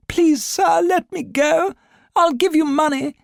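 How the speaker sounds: high, frightened plea for release; pleading cry. A narrator's reading. Terrified companion begging the kidnapper, high-pitched plea. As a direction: beg the captor in a panicked high voice. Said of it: "the gent's companion cried out".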